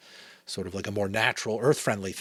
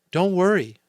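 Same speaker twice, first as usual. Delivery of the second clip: reassuring mother; 11 semitones higher, 10 dB louder than usual. A narrator's reading. Mother reassures her children in story.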